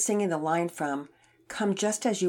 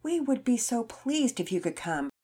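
earnest plea; 5 semitones higher than usual. Acted. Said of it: "she spoke up".

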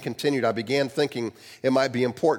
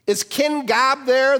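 much higher, slow, put-on operator voice; brisk operator; 12 semitones higher, 5 dB louder, much slower than usual. A narrator's reading. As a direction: cut in like a switchboard operator. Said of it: "the operator interrupted".

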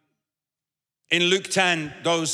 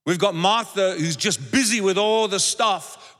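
ordinary talk

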